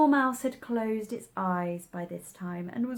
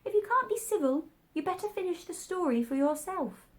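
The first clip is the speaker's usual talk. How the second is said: much higher, sulky squeaky voice; 10 semitones higher than usual. A narrator's reading. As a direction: grumble it in a high sulky voice. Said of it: "the Dormouse sulkily remarked".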